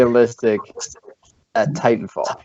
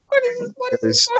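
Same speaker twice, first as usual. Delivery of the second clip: baffled; 4 dB quieter than usual. Retold or imitated. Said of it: "I'm like".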